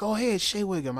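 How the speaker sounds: pleased surprise at seeing him; excited recognition. Retold or imitated. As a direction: spot a familiar face and light up. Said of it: "I was like".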